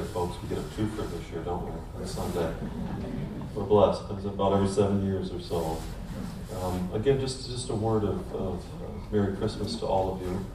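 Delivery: ordinary talk, nothing marked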